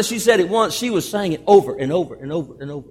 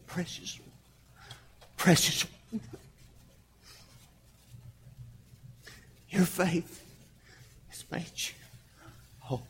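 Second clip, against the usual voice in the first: hushed, near-whispered tenderness; tender; 18 dB quieter than usual. Acted. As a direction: speak very softly, as if bending close to comfort. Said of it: "He's not saying it that way".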